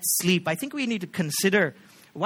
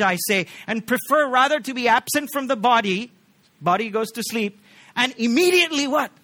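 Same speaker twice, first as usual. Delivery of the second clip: louder and higher, pressing each phrase home; full-force preaching; 4 semitones higher, 5 dB louder than usual. Acted. Pastor urging the congregation, preaching loud and high. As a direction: preach it out hard to the church.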